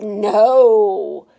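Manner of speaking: a loud, flat refusal; stubborn loud no. Acted. Stephanie refusing her mom's ponytail offer, loud and stubborn. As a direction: plant your feet and refuse.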